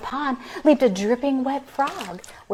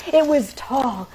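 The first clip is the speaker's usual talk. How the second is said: ordinary delivery, nothing marked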